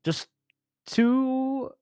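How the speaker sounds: drawn-out, stalling cover story; buy time while inventing the lie; slow cover story. Acted. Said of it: "Julio turns right away and goes".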